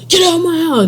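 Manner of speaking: high-pitched mock yell; imitated angry order. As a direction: act out someone throwing guests out. Acts Darnell ordering them out.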